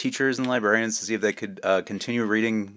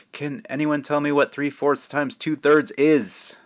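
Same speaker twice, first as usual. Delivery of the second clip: slow, higher teacher voice; teacher asking the class; 6 semitones higher, 4 dB louder, slower than usual. Acted. Teacher Mister Barberry quizzing the bored class. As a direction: ask it like a patient math teacher.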